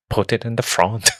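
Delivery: put-on a lighter second try at a Scottish accent on "Put it in the front". Friend repeats Ramphis's line, milder Scottish accent. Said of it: "he says with his Scottish accent".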